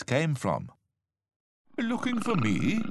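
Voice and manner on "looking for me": high-pitched surprised question; surprised tiger. A narrator's reading. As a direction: ask it high, rather taken aback. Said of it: "asked a rather surprised tiger".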